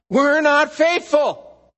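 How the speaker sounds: loud, slow, high-pitched cry; forceful preaching. Real, not acted. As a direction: hammer it home with full force. Preacher driving home human failure.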